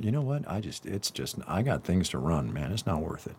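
quiet, low shrug of dismissal; softly acted bureaucrat bowing out; weary brush-off. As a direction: give up on it, muttered and resigned. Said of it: "the bar association going".

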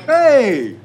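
loud, high-pitched shout of hello; loud greeting. Real, not acted. Host calls out to his guest on arrival, loud. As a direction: call out too loudly in greeting. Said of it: "that was really loud".

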